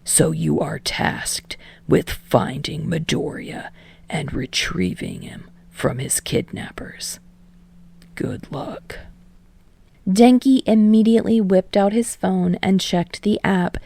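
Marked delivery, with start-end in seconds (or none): 0.0-9.0 hushed conspiratorial whisper, low. Acted. Teacher briefing students on a secret mission, whispered.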